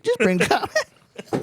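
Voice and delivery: high, slow squeaky line; squeaky repeat. Pretend. Pleading to Kendrick in a high squeak. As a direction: stretch it up into a squeak.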